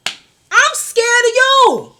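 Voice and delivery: very high, loud, slow mock scream of fear; mock fright. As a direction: wail it like a fake horror victim.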